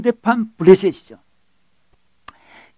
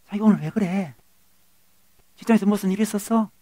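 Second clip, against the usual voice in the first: hushed, careful acted questions; soft wifely concern; 10 dB quieter than usual. Wife asks husband what happened at work, softly concerned. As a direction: imitate a wife gently probing.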